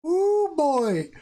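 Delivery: put-on a high, loud "ooh, boy"; excited ooh boy. Streamer telling viewers about his big gains, high and loud. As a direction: let the amazement burst out. Recalling watching the account jump.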